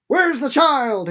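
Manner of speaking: high, loud, slow demand. A narrator's reading. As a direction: demand an answer. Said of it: "he demanded".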